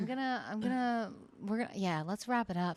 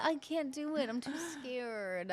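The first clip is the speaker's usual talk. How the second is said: mock scared; 5 semitones higher, 6 dB quieter, slower than usual. Acts out a frightened parent.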